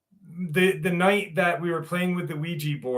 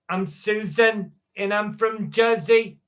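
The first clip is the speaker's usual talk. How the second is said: put-on a lighter, slower Jersey accent; a second, gentler try at a New Jersey accent; 6 semitones higher than usual. Streamer introducing herself to chat in a Jersey accent. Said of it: "That's my best Jersey accent".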